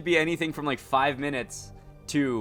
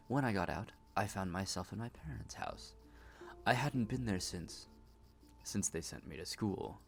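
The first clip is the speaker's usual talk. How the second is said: quiet troubled woman; 2 semitones lower, 9 dB quieter than usual. Acted. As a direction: tell it like a haunted confession. Voices patient telling her story.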